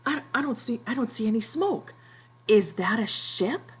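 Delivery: high, lightly voiced anxious question; anxious Piggy. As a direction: read Piggy peering at the horizon, half acted. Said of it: "Piggy looks up and he's like".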